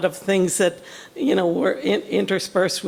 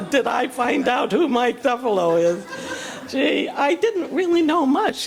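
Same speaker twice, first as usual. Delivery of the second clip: laughing, pitch jumping higher; amused laughing recollection; 5 semitones higher than usual. Real, not acted. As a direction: laugh through the question in disbelief. Laughs recalling discovering Mike Duffalo.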